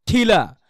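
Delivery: "খিলা" with an H released right after the K; K plus H. Shown as a demonstration. Teacher says K carries an H, aspirated "খিলা". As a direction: add a puff of H to the K in "খিলা". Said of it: "ক্ষয়ের সাথে একটা হও হবে".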